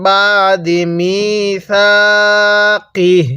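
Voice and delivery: slow drawn-out tajweed recitation; Quranic recitation. Shown as a demonstration. Teacher reciting slowly to Bengali students. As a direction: recite it slowly, stretching the vowels. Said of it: "এরকমভাবে শিখব".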